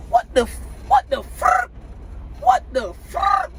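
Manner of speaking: high, startled what the fuck; shocked outburst. Retold or imitated. Trucker watching her run off, shocked high yelp. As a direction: pitch jumps way up in disbelief.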